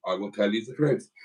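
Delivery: ordinary talk, nothing marked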